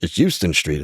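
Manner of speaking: imitating an outsider, "Houston" as HYOO-stun; out-of-towner's pronunciation. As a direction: quote a visitor saying "Houston" with a YOO sound. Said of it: "when they're like".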